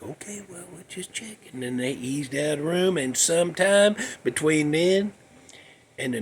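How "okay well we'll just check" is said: very soft, quiet reassurance; barely above a whisper; hushed. Retold or imitated. Caretakers quoted.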